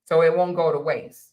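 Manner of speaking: ordinary talk, nothing marked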